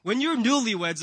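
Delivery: normal talking voice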